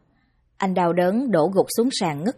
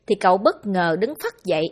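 ordinary delivery, nothing marked

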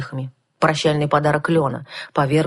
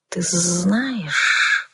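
calm dragon hiss; 3 semitones higher than usual. A narrator's reading. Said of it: "спокойно прошипел дракон".

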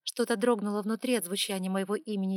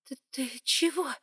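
frightened gasp; 6 semitones higher, 3 dB louder than usual. A narrator's reading. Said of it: "испуганно выдохнула я".

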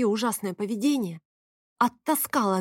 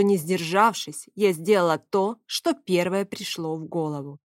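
usual speaking voice

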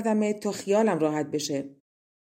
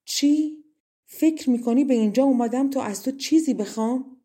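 higher-pitched outburst of protest; raised, startled retort; indignant cry; 5 semitones higher than usual. A narrator's reading. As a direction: cry out in offended disbelief. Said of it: "شاهدخت داد زد".

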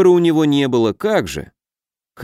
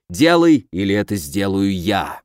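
furious order sinking into a threat; angry ultimatum; 5 semitones lower than usual. A narrator's reading. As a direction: burst out, then drop to a menacing low. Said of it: "разъярённого Самди".

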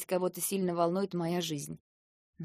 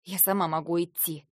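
grumbling. A narrator's reading. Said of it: "буркнула я".

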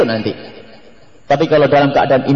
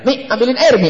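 higher-pitched, quick order to mum; blunt child's demand; 6 semitones higher than usual. Acted. Child ordering mother to fetch water, blunt and high. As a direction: imitate a child demanding a drink. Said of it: "anaknya bilang".

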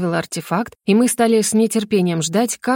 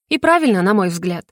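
ordinary delivery, nothing marked

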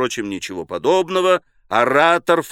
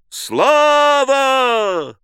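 high, long-held shout; loud drawn-out hail; crowd acclamation; 12 semitones higher, 5 dB louder than usual. A narrator's reading. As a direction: cheer it out, stretching the vowel.

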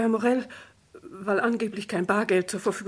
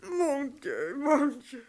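distressed wailing; 5 semitones higher, much slower than usual. Acted. Wife to inspector after his demands, distraught. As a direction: lament helplessly, overwhelmed by it all.

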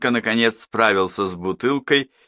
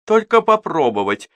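high, louder begging; pleading repeat; 9 semitones higher, 4 dB louder than usual. A narrator's reading. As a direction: plead to just taste it. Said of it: "повторила Сюзанна". (высокая настойчивая просьба)

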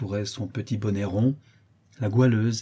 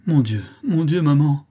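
anguished plea; 5 semitones higher, 6 dB louder than usual. Acted. Daughter pleads with mother.

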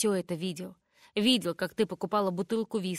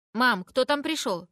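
slow, louder call from another room, pitched up; calling from inside; 4 semitones higher, 6 dB louder than usual. A narrator's reading. Rebecca calling to her mum from inside, slow loud call. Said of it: "слышу я голос Ребекки".

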